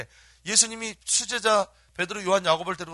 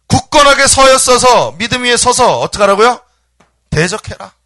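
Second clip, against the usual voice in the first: loud, raised-pitch exhortation; forceful preaching shout; 6 semitones higher, 18 dB louder than usual. Real, not acted. Preacher urging people to stand firm, forceful shout. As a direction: belt it out to the congregation. Preacher drives home the command.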